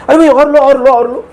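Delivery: urging food on a guest like a host; insistent host. Shown as a demonstration. Host urging a guest at home, pressing and insistent. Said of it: "एम्फैटिक जोर देने के लिए बोलते हैं ना".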